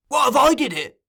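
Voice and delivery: high, loud cry of challenge; almost a yell. A narrator's reading. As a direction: burst out at a near-yell.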